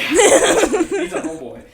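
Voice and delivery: slow, high, loud and laughing. Real, not acted. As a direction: draw it out through laughter. Joking about the doll.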